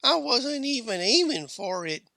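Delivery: put-on high-pitched child's confession; kid confessing. A narrator's reading. Kid confessing about the shot, high and sheepish. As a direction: own up in a high little voice. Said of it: "confessed the kid".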